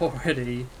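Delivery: normal talking voice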